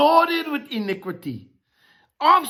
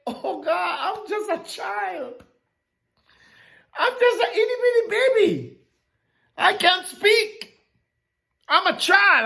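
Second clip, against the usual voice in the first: put-on whiny child. Jeremiah protesting to God like a small child. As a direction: play a tiny child pleading off. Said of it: "Jeremiah said".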